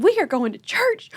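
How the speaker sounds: high-pitched scolding, each word punched; indignant mock yell; retold yelling. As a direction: act out a mom losing it. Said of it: "while I'm yelling in the car".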